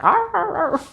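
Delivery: high yappy mock barking; dog bark impression. Imitating the puppy on the box barking or laughing. He's like.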